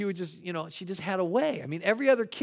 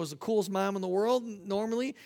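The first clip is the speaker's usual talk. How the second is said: ordinary delivery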